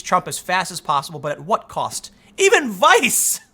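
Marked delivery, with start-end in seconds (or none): 2.4-3.4 high, loud, drawn-out exclamation; incredulous outburst. Real, not acted. Commentator stunned by the Vice headline, incredulous. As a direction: burst out in disbelief, stretching it high.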